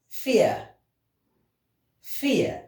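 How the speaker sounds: "Vier" said high, with an f sound, fee-er; teacher sings out Vier high for the class; model word for repeating. Shown as a demonstration. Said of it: "We pronounce it as vier".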